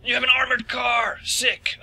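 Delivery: high, thrilled exclamation; excited. Real, not acted. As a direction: geek out over the ride. Player thrilled by the car.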